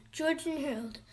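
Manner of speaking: ordinary delivery, nothing marked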